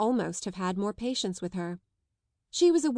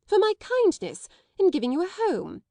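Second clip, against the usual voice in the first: indignant loud; 11 semitones higher, 7 dB louder than usual. A narrator's reading. Headmistress indignant.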